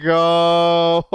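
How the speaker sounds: ordinary talk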